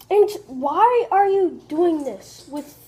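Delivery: loud, slow, high-pitched protest. Real, not acted. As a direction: call out in slow exasperation. Yells at friend mid-video.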